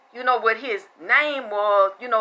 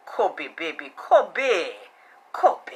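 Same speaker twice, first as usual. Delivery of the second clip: cooing voice; 6 semitones lower, much slower than usual. Retold or imitated. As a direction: coo the name sweetly. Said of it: "like a bitch".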